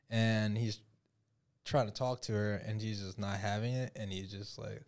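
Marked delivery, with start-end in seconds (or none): none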